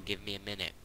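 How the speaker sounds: ordinary delivery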